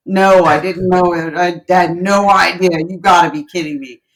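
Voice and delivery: loud, shocked disbelief; loud stunned manager in disbelief. Retold or imitated. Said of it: "he went, gasps".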